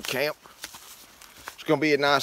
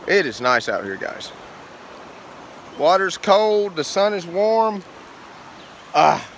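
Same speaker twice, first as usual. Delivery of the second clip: normal talking voice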